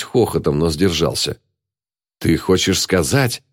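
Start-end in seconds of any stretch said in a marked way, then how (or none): none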